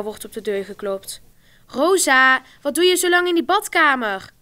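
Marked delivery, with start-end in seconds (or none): 1.7-4.3 loud call to the bathroom, higher pitch; calling through the door. A narrator's reading. Brother Alexander calling Rosa out of the bathroom, loud. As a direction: shout it through a closed door. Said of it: "roept Alexander".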